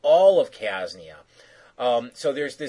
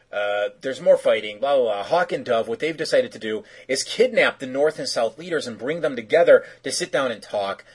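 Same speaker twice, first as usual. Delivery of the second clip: normal talking voice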